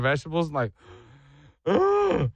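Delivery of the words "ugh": high-pitched retching ugh; disgusted groan. Retold or imitated. Kid facing vegetable hamburger helper, acted disgusted groan.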